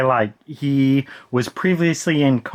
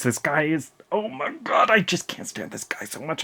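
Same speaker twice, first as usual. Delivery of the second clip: squeezed, quiet exasperation; strained groan of frustration, trailing softer; frustrated; 6 dB quieter than usual. Real, not acted. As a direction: at the end of your patience. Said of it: "I'm getting so frustrated".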